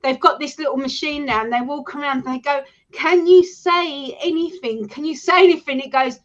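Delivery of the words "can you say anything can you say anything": slow, higher call to a spirit; ghost hunter calling out. Retold or imitated. Imitating ghost hunters addressing a spirit, slow mock calling. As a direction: draw it out as if questioning an empty room.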